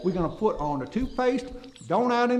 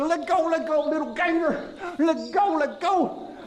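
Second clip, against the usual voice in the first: higher, insistent tugging plea; repeated higher pleading; pleading let go; 9 semitones higher than usual. Retold or imitated. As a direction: beg the gator to let go. Gator bites his paddle.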